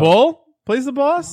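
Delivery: high, drawn-out disbelief; incredulous question. Real, not acted. Co-host stunned by who plays the boss, incredulous. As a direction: stretch it out, rising high in astonishment. Astonished question at casting news.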